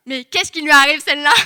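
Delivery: loud, high imitation of baffled onlookers; astonished mock outcry. Boys gawking at her huge appetite, astonished outcry.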